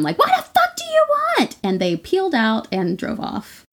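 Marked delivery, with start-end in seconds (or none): 0.2-1.6 high-pitched re-enacted yell; mock screaming, pitched way up in confrontation; retold scream. Jogger charging at the van following her, retold scream. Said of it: "started screaming at 'em".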